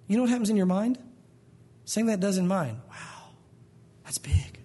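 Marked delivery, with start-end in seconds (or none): 2.9-4.6 whispered, daunted reaction; hushed awe. Acted. Inner thought on hearing of leukemia, awed whisper. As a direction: whisper it as if overwhelmed.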